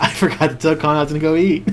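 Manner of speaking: quick line breaking into laughter; laughing realization. Retold or imitated. Telling how he forgot to tell a friend, laughing. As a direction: laugh through the retold admission.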